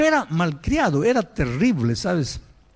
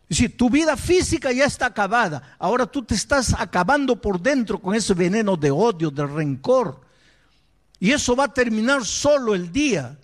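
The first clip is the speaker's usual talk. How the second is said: high-pitched, urgent preacher's rebuke; impassioned warning, voice raised in pitch; forceful rebuke; 6 semitones higher than usual. Retold or imitated. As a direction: confront him insistently, pitch pushed up. Rebuking the bitter young man.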